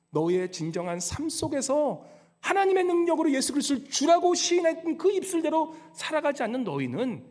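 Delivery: normal talking voice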